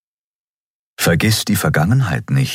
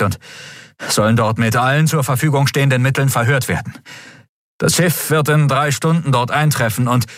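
lightly voiced aide, pitched higher; reporting subordinate; 6 semitones higher than usual. A narrator's reading. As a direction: report to your master, a touch higher. Subordinate hurriedly completing report to emperor. (leicht gespielter Untergebener beim Bericht)

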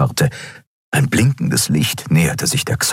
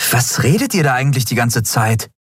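cutting in; 7 semitones higher than usual. A narrator's reading. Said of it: "fuhr ihn Endriel dazwischen".

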